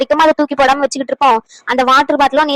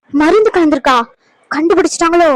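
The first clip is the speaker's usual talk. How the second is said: ordinary delivery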